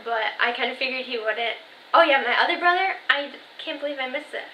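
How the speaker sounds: normal talking voice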